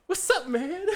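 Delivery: very high, hammed-up hello; over-familiar greeting. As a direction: imitate a boss greeting a stranger like an old buddy. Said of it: "you ever see the Obama".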